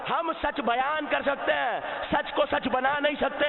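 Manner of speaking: fiery pulpit cry; preaching at full force. Acted. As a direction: hammer the point home to the crowd. Fervent majlis oration.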